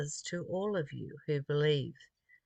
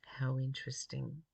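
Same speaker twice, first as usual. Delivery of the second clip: whisper; 3 semitones lower, 6 dB quieter than usual. Real, not acted. Whispered personal reflection.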